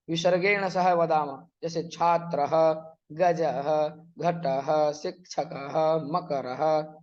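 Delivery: slow and careful, let each "ः" breathe out; with clear visarga. Shown as a demonstration. Teacher drilling students, pronouncing every visarga clearly. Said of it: "विसर्ग सहित बोलना है".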